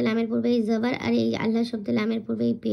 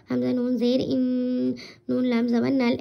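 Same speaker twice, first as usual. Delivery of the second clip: usual speaking voice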